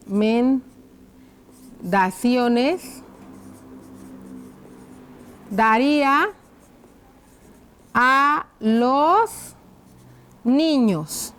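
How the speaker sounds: very drawn-out, broken into pieces; slow dictation. Shown as a demonstration. Teacher writing the closing question for children, slow dictation. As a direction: say each piece slowly as your hand writes it.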